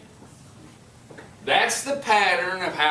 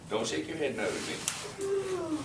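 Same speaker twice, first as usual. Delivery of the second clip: playful scolding; 11 semitones higher, 10 dB quieter than usual. Real, not acted. Teacher teases nodding student.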